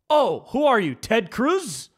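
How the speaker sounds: sarcastic jab. Retold or imitated. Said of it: "In my head, I was like".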